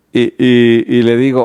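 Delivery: ordinary talk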